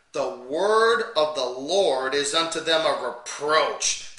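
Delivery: loud, slow, booming King James reading; preacher thundering out the prophet's words; fiery scripture reading. Acted. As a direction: proclaim the verses from the pulpit. Reads scripture at full force.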